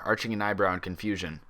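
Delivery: usual speaking voice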